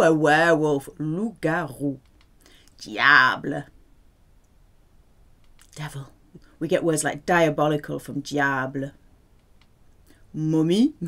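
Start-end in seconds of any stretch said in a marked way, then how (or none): none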